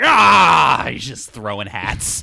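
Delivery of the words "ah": sudden hammy shout of attack; battle yell. Retold or imitated. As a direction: bark out a henchman's war cry. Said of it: "he's like".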